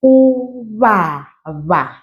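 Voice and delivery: uvava drawn out slowly, every syllable clear; slowed way down. Shown as a demonstration. Teacher slowing a word for beginners. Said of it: "I'm slowing down".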